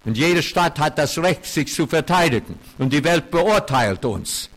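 a preacher pressing his point hard on the congregation; forceful preaching. Acted. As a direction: drive each clause home from the pulpit. Pastor preaching on Israel's guilt.

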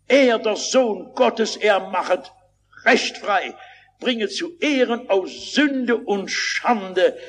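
high, slow declaimed verse; stately, chant-like preacher's declamation; hymn recitation. Real, not acted. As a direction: recite it as triumphant proclamation.

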